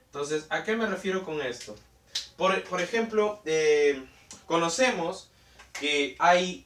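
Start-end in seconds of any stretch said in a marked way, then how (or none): none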